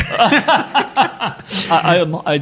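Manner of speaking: laughing through the words; flustered laugh at the praise. Real, not acted. [name] thanked for his Scottish accent, laughing.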